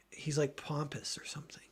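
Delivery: hushed unvoiced aside; whispered. Real, not acted. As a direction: whisper it like a secret. Whispered aside about cousin.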